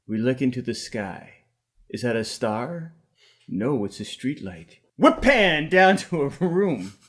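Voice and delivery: slow, deliberate screenplay reading; screenplay stage directions. Acted. Reading stage directions to the podcast group, drawn out and portentous. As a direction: read it slowly with long dramatic pauses.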